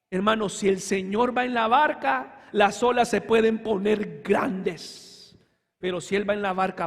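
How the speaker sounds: ordinary talk, nothing marked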